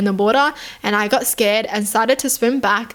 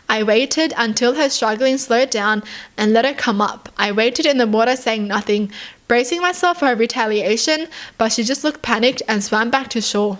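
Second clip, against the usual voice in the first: usual speaking voice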